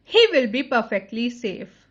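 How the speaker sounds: usual speaking voice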